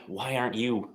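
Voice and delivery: normal talking voice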